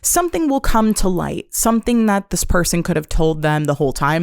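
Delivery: ordinary talk, nothing marked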